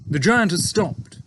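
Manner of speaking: a hint of a breezy young officer; young pilot. A narrator's reading. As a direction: pilot answering his boss, lightly voiced breezy officer. Pilot voiced in read story.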